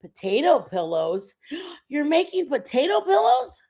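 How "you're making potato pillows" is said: high, loud amazed question; gasp, then exclaim in delight; astonished, pitched-up reaction; gasping surprise. Real, not acted. Gasping at a viewer's news.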